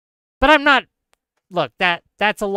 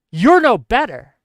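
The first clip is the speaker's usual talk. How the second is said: emphatic louder rebuke; 3 semitones higher, 7 dB louder than usual. Real, not acted. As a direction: hammer it home louder.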